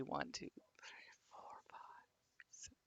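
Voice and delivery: counting under the breath in a whisper; hushed tally whisper. Real, not acted.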